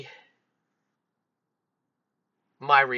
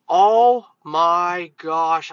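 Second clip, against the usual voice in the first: usual speaking voice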